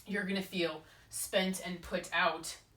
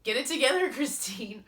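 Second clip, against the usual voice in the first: self-scolding; 6 semitones higher, 7 dB louder than usual. Acted. Scolding herself.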